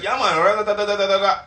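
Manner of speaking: high, rattled-off babble; mimicked gaslighter. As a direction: imitate someone glibly talking in nonsense syllables. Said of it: "And they'll be like".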